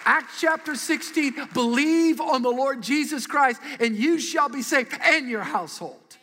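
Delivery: slow, declaiming a Bible verse aloud to the church; proclaimed scripture reading. Acted. Pastor proclaiming Acts to the congregation, slow and ringing.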